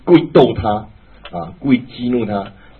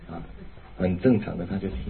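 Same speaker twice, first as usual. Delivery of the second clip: normal talking voice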